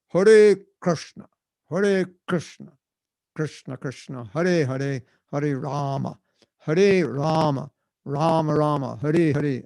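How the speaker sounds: over-precise "Hare Krishna", each word drawn out with tapped r's; strict proper pronunciation. Acted. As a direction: intone "Hare Krishna, Hare Rama" slowly and emphatically, like a pedant. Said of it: "pronounce it like this".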